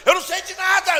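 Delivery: high, loud, half-laughing protest; near-shouted denial. Retold or imitated. Preacher overwhelmed by questions, shouting his denial.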